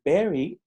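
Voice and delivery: "very" said with a Spanish B sound, no V; Spanish-style very. Shown as a demonstration. Teacher demonstrates a learner's "very" with a B sound. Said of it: "igual está usando fonética del español".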